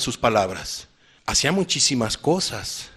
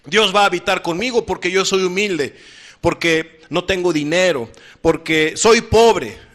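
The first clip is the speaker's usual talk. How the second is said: mock self-righteous claim; 5 semitones higher, 4 dB louder than usual. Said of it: "las personas dicen".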